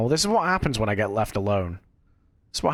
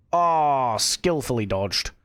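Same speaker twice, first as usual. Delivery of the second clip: ordinary talk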